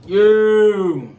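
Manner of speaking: loud, high, drawn-out holler; hyped shout. Real, not acted. Loud called-out show greeting.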